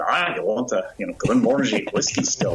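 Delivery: imitating a Scottish still maker; Scottish accent. Still maker questioning customers about their order, Scottish accent. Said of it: "in this beautiful Scottish accent".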